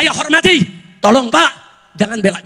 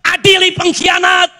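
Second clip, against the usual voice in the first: higher-pitched mock crowd chant; imitated protest cry; 6 semitones higher, slower than usual. Preacher quoting people demanding trial for traitors, mild mock yell. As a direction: call it out like a protester, without full volume. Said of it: "Orang lagi teriak".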